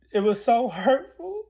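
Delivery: slow, high and choked with real hurt; real tearful confession. Real, not acted. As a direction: let the hurt well up into crying. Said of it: "I'm feeling like how I feel now".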